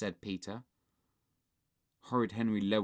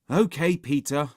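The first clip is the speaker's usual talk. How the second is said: grudging, louder agreement; scowling; 4 semitones higher, 8 dB louder than usual. A narrator's reading. Henry gives in to Peter, scowling. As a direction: give in with a scowl. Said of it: "said Henry, scowling".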